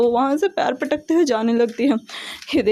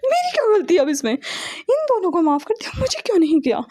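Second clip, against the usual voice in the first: indignant protest; 6 semitones higher than usual. A narrator's reading. Brother protests sister's unfairness.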